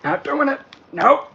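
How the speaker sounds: high, loud "nope, not doing it"; a sassy refusal, voice jumping up; card's refusal. Retold or imitated. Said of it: "the one that's like".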